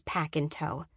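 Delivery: usual speaking voice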